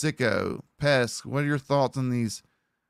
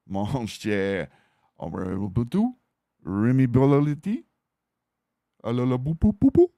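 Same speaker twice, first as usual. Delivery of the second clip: hammed-up Cajun accent, babbling upward; Gambit; 3 semitones higher, 4 dB louder, slower than usual. Shown as a demonstration. Streamer goofing on a Gambit voice, in a hammed-up Cajun accent. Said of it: "whenever you're doing something like Gambit".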